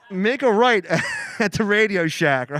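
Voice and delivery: loud mock GPS directions; outdated GPS voice. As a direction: announce the turn loudly like an old GPS. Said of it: "Mine still says things like".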